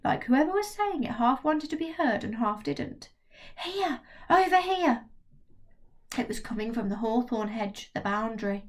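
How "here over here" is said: higher, louder stage whisper. Acted. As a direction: call out half hidden, half heard. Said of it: "a loud whisper".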